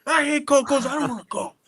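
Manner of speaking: imitating a whining kid; whiny kindergartner. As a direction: whine like a stubborn five-year-old. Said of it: "Kids in kinder".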